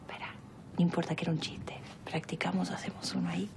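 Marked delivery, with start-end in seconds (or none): 0.0-0.3 quick hushed whisper; soft whispered wait. Real, not acted. Whispering near sleeping babies. 2.7-3.5 whisper. Real, not acted. Whispering near sleeping babies.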